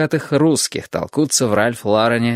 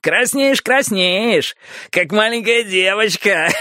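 high sing-song teasing, then laughing; mocking laughter; 12 semitones higher, slower than usual. Boris mocks Potter for blushing, laughing. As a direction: taunt him like a schoolboy.

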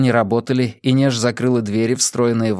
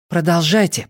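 higher, pressing command; hurrying them on; 6 semitones higher than usual. A narrator's reading. Inej urges the drillers to continue, hurrying. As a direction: urge them on, pitch raised. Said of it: "поторопила Инеж".

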